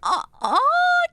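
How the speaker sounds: ordinary talk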